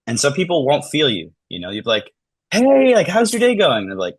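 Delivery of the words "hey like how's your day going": much higher, louder bright hello; overly cheery greeting. Retold or imitated. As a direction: sing out a friendly hi to a stranger.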